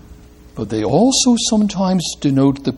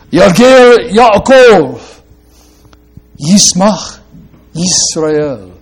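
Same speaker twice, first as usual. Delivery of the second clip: "Yalgel Yaakov" loud and slow, then "Yismach Yisrael" settling; emphatic Hebrew recitation; 3 semitones higher, 6 dB louder, slower than usual. Shown as a demonstration. Preacher proclaiming the Hebrew verse to the congregation, slow and loud. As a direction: proclaim the rhyme slowly and strongly. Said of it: "rhyming ... in the first syllables".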